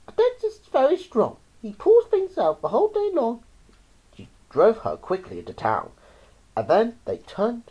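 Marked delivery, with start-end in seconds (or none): 0.1-3.4 high-pitched mother, firmly explaining; mum's voice. A narrator's reading. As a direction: voice the mum high and a little louder. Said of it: "She said".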